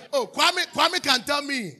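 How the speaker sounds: loud, high call of a name, slow. Real, not acted. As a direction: call across the church. Calling out to Kwame.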